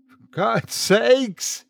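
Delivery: high, drawn-out despairing cry; shout. A narrator's reading. Father shouting after his family has gone, high and slow. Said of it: "he shouted again".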